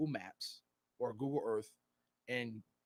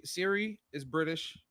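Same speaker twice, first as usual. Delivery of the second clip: ordinary delivery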